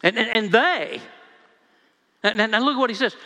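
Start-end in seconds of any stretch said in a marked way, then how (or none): 0.0-1.0 "they" rising then falling, with disdain; stress on THEY. Shown as a demonstration. Preacher voices Luke's disdain for the disciples, stressing "they". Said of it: "is disdaining these guys".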